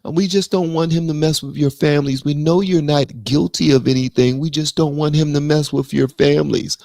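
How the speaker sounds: lightly voiced sarcastic politician; mocking Biden's excuse. Mimicking the pardon excuse to his viewers, mock sincere.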